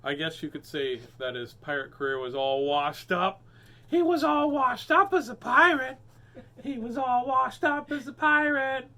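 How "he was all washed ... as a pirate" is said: high, loud silly voice; milk the pun in a goofy voice; punchline voice. Pretend. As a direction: reader hamming the final pun. Punchline repeated in funny voice.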